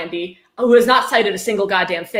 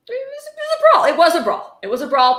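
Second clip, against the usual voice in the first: laughing disbelief; 8 semitones higher, 4 dB louder than usual. Real, not acted. Laughing at the book's wording.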